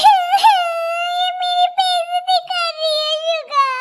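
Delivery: high, drawn-out tearful wail; whiny fake crying. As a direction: wail like an offended child tattling. Mocked character whines to friend.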